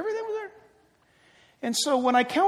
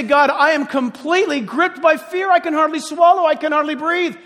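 desperate prayer; 5 semitones higher, 4 dB louder than usual. Shown as a demonstration. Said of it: "You go to God and you say".

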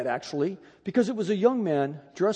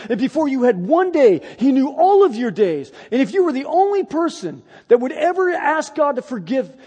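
fervent preaching; 4 semitones higher, 4 dB louder than usual. Retold or imitated. Preaching God's love to him.

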